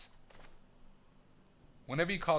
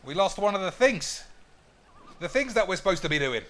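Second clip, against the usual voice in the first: louder, higher, laughing repeat; 6 semitones higher, 7 dB louder than usual. Real, not acted. Player loudly repeating the loss, laughing. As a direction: announce it again with a laugh. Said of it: "repeat louder".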